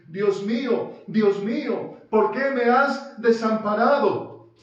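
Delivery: loud, high anguished cry of "Dios mío"; Jesus's cry, loud and anguished. Acted. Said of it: "lo que Jesús estaba diciendo".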